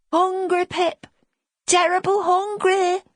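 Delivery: put-on high pleading child voice; a little lad begging for food; hungry small boy. Small boy crying hunger.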